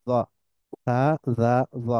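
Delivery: ordinary talk, nothing marked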